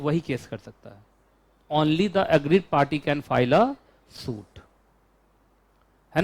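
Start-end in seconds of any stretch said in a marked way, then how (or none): 1.7-4.6 very slow, spaced words; slow dictation. Shown as a demonstration. Teacher dictating the maxim to the class slowly. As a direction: read it out for copying. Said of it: "dictating the maxim".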